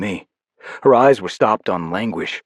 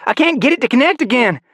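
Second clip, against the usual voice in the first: worried; 4 dB louder than usual. Acted. Panic over failing device.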